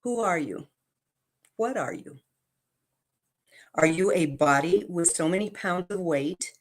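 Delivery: ordinary delivery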